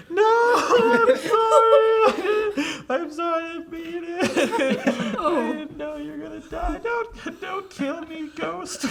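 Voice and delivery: high, loud, laughing sobbing plea; terrified crying. Acted. GM as Thomas begging the ghost, high, sobbing and laughing. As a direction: beg the ghost through panicked laughing sobs. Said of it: "on his knees crying".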